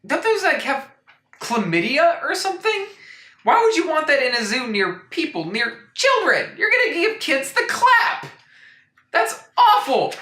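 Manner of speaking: high-pitched, scandalised squeal; hammed-up alarm over a zoo; mock outrage. Real, not acted. Mock outrage about disease.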